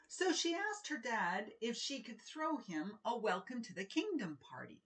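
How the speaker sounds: put-on lively picture book reading for children; animated children's book narration; storybook read-aloud. A narrator's reading. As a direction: read it like story time with kids.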